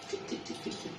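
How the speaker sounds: breathy whispered tip tip tip; hushed tiptoe sound effect. Acted. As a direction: whisper the steps like sneaking out.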